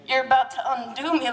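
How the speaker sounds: strained through clenched teeth; gritted teeth. Acted. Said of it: "he said through gritted teeth".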